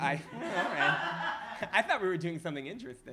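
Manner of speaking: amused protest, laughing. Real, not acted. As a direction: tease back through real laughter. Laughs, mock-protesting the dull question.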